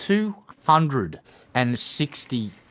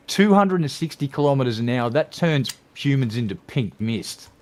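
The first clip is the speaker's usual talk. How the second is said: normal talking voice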